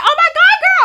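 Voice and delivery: put-on very high and loud girlish squeal; squealing greeting. Greeting the classmate at the party, loud high squeal. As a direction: squeal it like meeting your bestie. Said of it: "I'm like".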